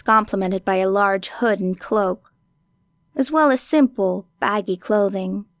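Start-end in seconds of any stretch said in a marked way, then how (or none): none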